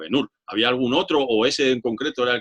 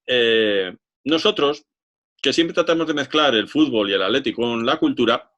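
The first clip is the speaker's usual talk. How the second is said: usual speaking voice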